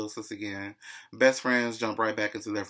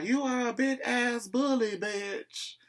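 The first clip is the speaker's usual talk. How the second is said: high-pitched mock country drawl; high, countrified mocking retelling; 13 semitones higher, slower than usual. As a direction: imitate her country voice, pitched way up. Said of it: "In her country voice".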